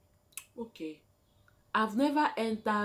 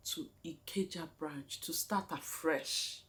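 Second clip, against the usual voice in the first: ordinary delivery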